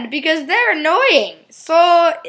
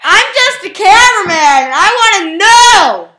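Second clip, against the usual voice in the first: loud, higher protest; indignant shout; 5 semitones higher, 9 dB louder than usual. Acted. Cameraman shouting back at the host, indignant. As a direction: yell back in mock offence.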